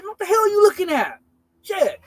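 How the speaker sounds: high, loud snap at the driver; angry yell. Retold or imitated. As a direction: bark at him to watch the road. Yelling at the distracted driver.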